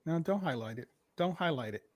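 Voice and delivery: ordinary talk, nothing marked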